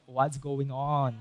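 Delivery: slow, hushed bewilderment; confused. Acted. Preacher voices the disciples without hope, confused and lost. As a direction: drag it out softly, at a loss. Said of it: "they were in a state of confusion".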